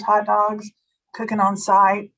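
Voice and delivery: ordinary talk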